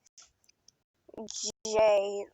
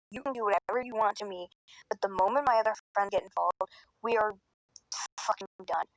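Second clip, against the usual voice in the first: normal talking voice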